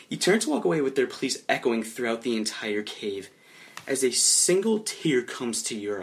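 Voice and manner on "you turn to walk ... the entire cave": ordinary talk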